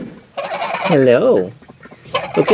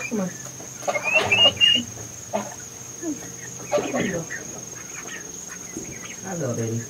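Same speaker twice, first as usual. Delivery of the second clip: much softer murmur to the chicks; soft coaxing; 7 semitones higher, 8 dB quieter, faster than usual. Real, not acted. Owner softly coaxing the chicks into the coop. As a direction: coax them in gently, softly.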